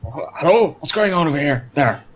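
lightly voiced puzzled alien calling out; confused hail. Rigel calling the ship, mildly baffled hail. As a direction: call out baffled over the line.

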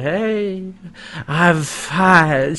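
put-on sloppy drunken greeting. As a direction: sound a few drinks too many. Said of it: "she's actually doing, like, perfect, like, drunken voice".

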